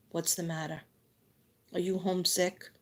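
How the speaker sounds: soft, quiet concerned mother's question; gentle mom. Retold or imitated. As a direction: softly voice a caring mom checking in. Mother voiced comforting homesick child.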